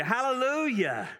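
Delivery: high, ringing Hallelujah, said the way it was written; fervent hallelujah. Shown as a demonstration. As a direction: answer the crowd with full joy. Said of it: "that's not the way it was written".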